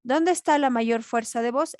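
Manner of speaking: ordinary talk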